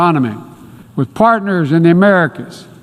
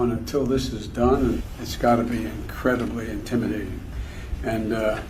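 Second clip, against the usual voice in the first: mumbling; 6 semitones lower, 12 dB quieter than usual. Real, not acted. Faint mumbling address.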